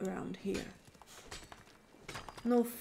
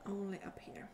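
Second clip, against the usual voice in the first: whisper; 13 dB quieter, faster than usual. Real, not acted. Quiet aside while playing.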